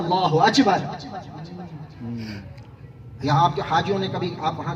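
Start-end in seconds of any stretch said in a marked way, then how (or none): 0.0-0.8 "اکبر" said as "achbar", with ch for k; Persian-style "اکبر" with a ch sound; Persian-speaker pronunciation. Retold or imitated. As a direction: imitate Persian speakers turning the kaf in "اکبر" into ch. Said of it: "وہ فارسی والے کہتے ہیں".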